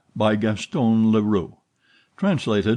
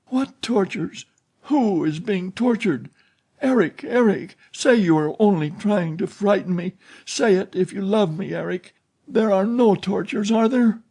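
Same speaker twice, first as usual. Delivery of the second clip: put-on much higher, pleading, frightened girl; a terrified girl begging her captor; swooning terror; 11 semitones higher than usual. A narrator's reading. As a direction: beg him to say it isn't true. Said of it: "the girl's swooning voice".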